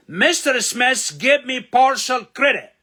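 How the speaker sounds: slow, loud, high teacher reading; slow emphatic reading. Shown as a demonstration. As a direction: read it out slowly and loudly for the class. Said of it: "أقف عند partial وأقف عند give me".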